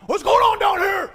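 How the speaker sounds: loud, high bellow down the stairs; angry shout. Retold or imitated. Fed-up father yelling at noisy kids downstairs, loud and high. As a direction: yell it like a fed-up dad.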